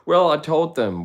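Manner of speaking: carefree bandmate laughing it off; jolly Todd. Retold or imitated. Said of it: "he's a jolly guy".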